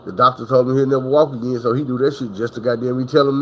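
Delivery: normal talking voice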